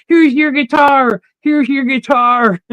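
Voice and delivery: high, drawn-out mock yell; imitated screaming. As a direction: mimic an excited scream, stretching the words out. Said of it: "I hear somebody screaming and screaming".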